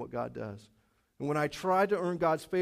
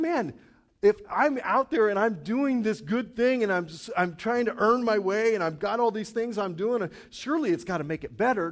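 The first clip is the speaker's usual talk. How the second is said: eager self-talk; 5 semitones higher, 5 dB louder than usual. Retold or imitated. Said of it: "You're thinking".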